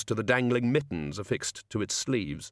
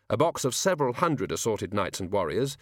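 usual speaking voice

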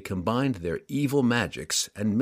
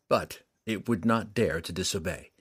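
ordinary delivery, nothing marked

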